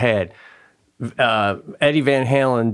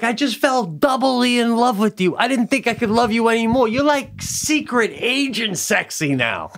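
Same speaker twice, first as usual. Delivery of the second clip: higher-pitched smitten wife voice; imitated adoring wife; 8 semitones higher than usual. Wife hears his French in the restaurant, mock swooning. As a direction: gush like an impressed wife, playfully put on. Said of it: "your own wife would be like".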